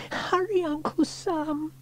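very high, stretched plea; girl's urgent cry. A narrator's reading. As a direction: plead for haste as a little girl. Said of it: "she cried".

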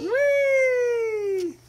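high gleeful squeal; a playful swooping cry for the toy jet; whee. Pretend. Parent reading to child squeals for the baby's jet.

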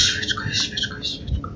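hushed whisper. Real, not acted. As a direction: breathe the words out in a whisper. Whispering to herself while hiding.

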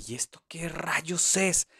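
slow, hushed disbelief; whispered bafflement. Retold or imitated. Teacher recalling first hearing cucumber, baffled whisper.

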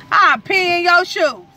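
teasing shriek at husband; loud playful threat. Real, not acted. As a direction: shriek the threat through a grin. Repeats threat louder, laughing.

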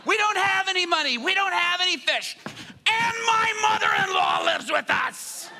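high, exasperated shouting that builds; frustrated yelling. Acted. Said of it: "he's getting frustrated".

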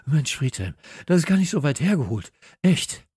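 loud, high, hurried and winded; acted out of breath; panting. A narrator's reading. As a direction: blurt it out as if you ran all the way. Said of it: "Kalle keuchte, als wäre er den ganzen Weg zum Garten gerannt".

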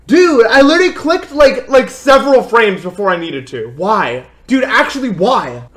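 high, loud exasperated yelling at the game; real gamer rage at a mistimed click; whiny high-pitched complaint; frustrated outburst. Real, not acted. Streamer fails jump, frustrated outburst.

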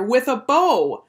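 loud, high, drawn-out "bow"; slow, emphatic boyish demand; insistent exclamation. A narrator's reading. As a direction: stretch it out so mother gets it. Boy insists to his mother.

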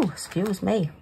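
ordinary delivery

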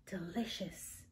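drowsy, soft unvoiced whisper; child whispering through breakfast; sleepy whisper. A narrator's reading. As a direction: mumble it half asleep. Sleepy child eating, eyes closed.

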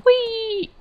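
very high squeal of fun; gleeful wee. Real, not acted. Gamer jumps his horse over a fence: gleeful squeal.

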